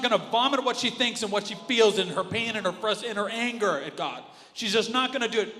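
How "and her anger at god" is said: normal talking voice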